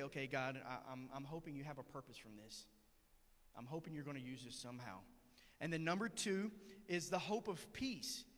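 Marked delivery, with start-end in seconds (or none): 0.0-5.0 soft, hushed inner prayer; a softly murmured hopeful prayer; quiet prayer. Retold or imitated. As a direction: pray it under your breath to God.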